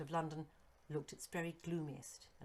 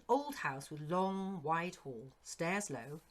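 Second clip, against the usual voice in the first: ordinary delivery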